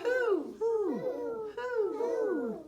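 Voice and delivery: very high, loud owl calls; owl hoots. Shown as a demonstration. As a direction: hoot three times like an owl. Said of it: "An owl says".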